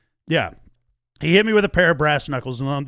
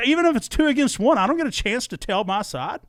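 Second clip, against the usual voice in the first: indignant outburst; 9 semitones higher than usual. Retold or imitated. Retelling the fight with the investigator, incredulous outrage. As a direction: throw the question back in outrage.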